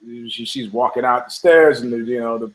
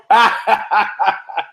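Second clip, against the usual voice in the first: high, rolling burst of laughter; real high cackling laugh; 7 semitones higher than usual. Real, not acted. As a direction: laugh freely at your own joke. Cracks up at own joke.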